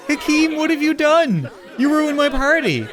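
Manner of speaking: high, laughing mock outrage; laughing dismay. Real, not acted. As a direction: laugh through the complaint. Butler crying at her party.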